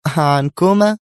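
loud, high mocking question; sarcastic. A narrator's reading. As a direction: echo it with open scorn. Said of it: "قالت نادية في سخرية". (بسخرية)

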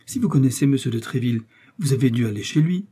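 ordinary delivery

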